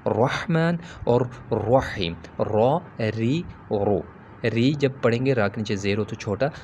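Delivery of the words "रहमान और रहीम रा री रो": रहमान और रहीम, रा री रो, drawn out slowly with a full heavy रा; slowly, thick full रा; heavy Arabic ra. Shown as a demonstration. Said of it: "रा को मोटा पढ़ा जाएगा".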